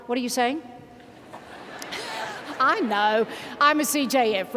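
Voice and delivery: ordinary talk, nothing marked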